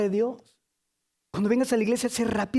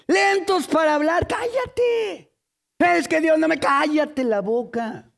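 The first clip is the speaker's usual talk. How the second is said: scolding outburst; 6 semitones higher, slower than usual. Acted. Preacher mimics telling a complainer to shut up, scolding. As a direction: cut them off, raised voice, drawing out the last word.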